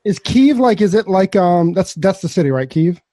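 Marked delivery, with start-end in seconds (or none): none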